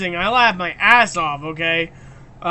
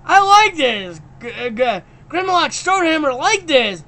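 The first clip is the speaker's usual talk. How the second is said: higher, louder goofy character voice; Grim's voice; 5 semitones higher, 3 dB louder than usual. Retold or imitated. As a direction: play the delighted dimwit. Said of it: "Grim's like".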